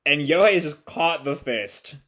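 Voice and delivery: normal talking voice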